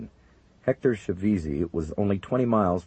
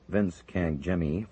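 ordinary delivery

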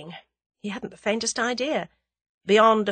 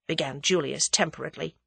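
ordinary delivery

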